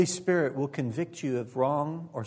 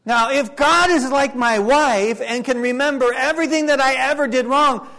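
full-force preaching; 10 semitones higher, 11 dB louder than usual. Acted. Preacher warning the congregation, loud and high. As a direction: preach it out to the whole room.

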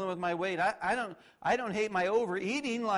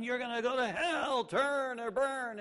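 higher, drawn-out mock street preacher yell; imitating a street yell; 7 semitones higher than usual. Campus street preacher yells at passing students, as a higher, slow mock yell. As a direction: ham up the bullhorn preacher's cry. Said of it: "you know, yelling".

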